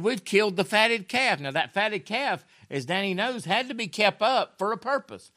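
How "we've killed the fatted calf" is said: high, loud, eager announcement; excited father. Acted. Father telling his sulking son about the feast, high and loud. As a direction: call it out like news of a feast.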